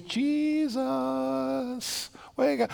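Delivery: soft ear-side call. Pretend. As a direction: gently rouse a sleeping man. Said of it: "get down close to his ear".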